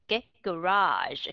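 "garage" said slowly with the r stretched out; long r in garage. Shown as a demonstration. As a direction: linger on the r in "garage". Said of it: "âm R ở trong đó người ta sẽ kéo dài".

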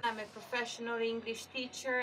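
usual speaking voice

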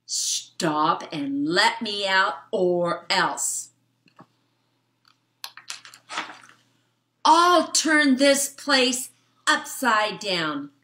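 slow, rising fly voice threatening to break out; a tiny fly making big threats; small bug's mock menace; trapped fly. Reads the trapped fly's lines.

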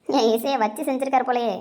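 ordinary delivery

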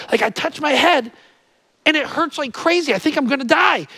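mock-panicked hypochondriac; anxious patient.

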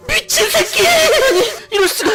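high stunned cry; shocked outburst. Acted. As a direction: blurt it out in shock. Shocked onlooker reaction. (깜짝 놀란 외침)

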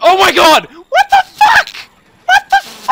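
high, loud shriek of disbelief; shocked scream. Real, not acted. Shock at game event.

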